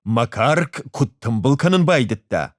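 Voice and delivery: usual speaking voice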